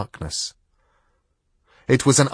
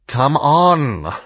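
cat voice; 6 semitones higher, 6 dB louder than usual. A narrator's reading. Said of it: "said the cat".

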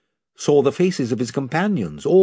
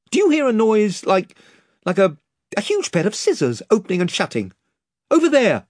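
higher, anxious question; uneasy, stammering in the dark; nervous boy; 8 semitones higher than usual. A narrator's reading. Eustace frightened in the dark.

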